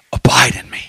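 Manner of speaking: whispered, low and tender. Acted. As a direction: breathe it as an intimate whisper. Whispered quote of Jesus.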